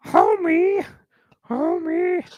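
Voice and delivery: very high strained Homie; Marge impression. Calling Homer as Marge, very high. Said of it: "That's Marge".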